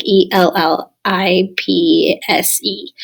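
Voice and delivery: spelling out letters. Shown as a demonstration. Teacher spells out the term.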